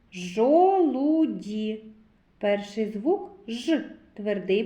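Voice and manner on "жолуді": "Жолуді" slowly stretched, each vowel held; sound-by-sound. Shown as a demonstration. Teacher starts sound analysis for children, "Жолуді" very drawn out. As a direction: draw out all three syllables of "Жолуді". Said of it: "Звуковий аналіз слова жолуді".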